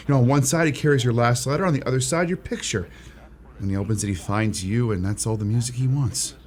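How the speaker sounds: low, softened romantic pitch; tender persuasion. Acted. Shop clerk tells Miss Smith how romantic a wallet is, tenderly.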